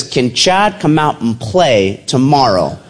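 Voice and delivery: drawn out with a chuckle; slow amused reading. Retold or imitated. Reading the seal's email to his son, slow and amused. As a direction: read slowly, half laughing.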